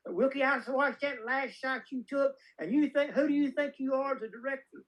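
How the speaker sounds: put-on high-pitched old lady telling someone off; Renee Valente. Old producer dressing down a director, high scolding impression. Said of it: "this is exactly how she said it".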